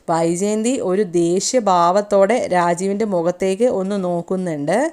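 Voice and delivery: plain reading voice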